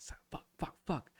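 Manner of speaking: breathy whispered curses; whispered cursing. Retold or imitated. Trainee curses at himself in a whisper. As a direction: hiss the curses under your breath.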